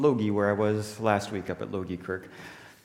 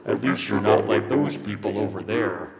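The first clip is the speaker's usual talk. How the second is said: distorted voice-changer effect; sly comparison through a toy microphone; electronically altered voice of the world; misleading voice; 4 dB louder than usual. Acted. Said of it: "That's a voice you shouldn't listen to".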